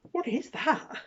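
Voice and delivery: high, loud disbelief; incredulous. Real, not acted. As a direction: ask it in disbelief. Pointing at odd bit.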